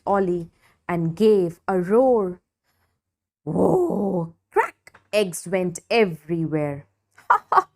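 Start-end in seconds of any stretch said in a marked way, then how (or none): 3.4-4.3 startled whoa. A narrator's reading. Ollie startled. 4.5-5.0 crack sound word. A narrator's reading. Eggs break. 7.3-7.7 high loud teasing laugh; mocking ha ha. A narrator's reading. Sid laughing at Ollie's broken eggs. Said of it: "laughed Sid".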